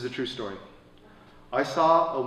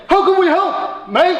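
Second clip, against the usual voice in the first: put-on 1 quoted Aussie boys; 8 dB louder than usual. Teen boys offering help.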